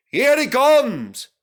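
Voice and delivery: loud, slow excited yell. A narrator's reading. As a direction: shout it across the station. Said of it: "yelled Douglas".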